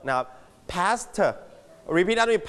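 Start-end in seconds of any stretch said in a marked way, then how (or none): 0.7-1.2 "past" with a separate light T released at the end; light final T. Shown as a demonstration. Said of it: "ให้มี ถ เบาๆ". (ออกเสียง "past" มี ถ เบาๆ ท้ายคำ)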